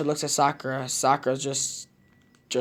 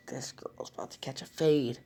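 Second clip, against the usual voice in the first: hushed, exasperated aside; muttered threat; 2 semitones lower, 7 dB quieter than usual. A narrator's reading. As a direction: mutter it under your breath. Sakura's muttered thought while facepalming.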